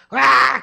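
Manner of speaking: put-on high, loud toddler shriek; baby scream. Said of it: "empieza a gritar". (grito agudo de bebé)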